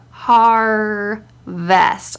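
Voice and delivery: har... vest, very slow with each syllable held. Shown as a demonstration. Mum breaking a spelling word down for him, slow syllables. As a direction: draw out HARVEST as two separate syllables. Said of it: "break it down syllable by syllable, like, slowly".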